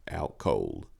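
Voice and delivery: plain reading voice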